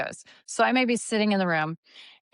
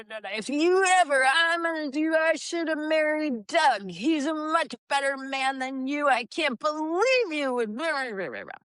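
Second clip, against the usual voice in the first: playful mock tirade, much higher; angry part; 6 semitones higher than usual. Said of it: "It's gonna speak in this angry way".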